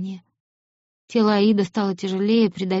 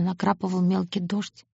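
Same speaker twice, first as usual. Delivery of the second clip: ordinary delivery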